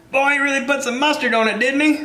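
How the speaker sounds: high-pitched commentator exclaiming over a pitch; sports commentator. Acted. Joking about a pitching term, mock commentator. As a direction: call it like a pitch, voice pushed way up. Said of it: "It sounds like a pitching term".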